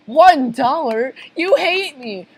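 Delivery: wailing, high-pitched daughter; hurt, dramatic teenage protest; tearful outrage. Acted. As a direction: whine as if about to burst into tears. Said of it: "Pearl begins to cry".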